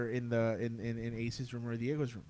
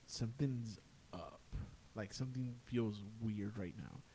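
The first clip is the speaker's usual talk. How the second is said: hushed unease; 3 semitones lower, 8 dB quieter than usual. Retold or imitated. Late-night talk with his aunt turning eerie, hushed and uneasy. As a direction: say it low, sensing something wrong.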